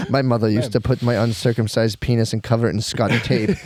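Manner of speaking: put-on French accent. Grown son recalling his mother's remedy, mock French accent. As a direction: reminisce in a hammed-up French accent. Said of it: "That's a French accent".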